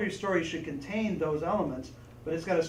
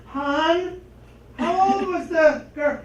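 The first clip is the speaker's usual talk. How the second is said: mom voice; 11 semitones higher, 10 dB louder, much slower than usual. Retold or imitated. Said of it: "My, my mom was great at this".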